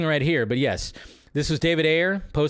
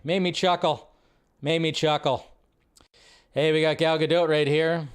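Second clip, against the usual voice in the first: ordinary talk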